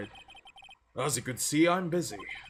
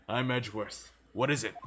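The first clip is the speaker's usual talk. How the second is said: ordinary talk, nothing marked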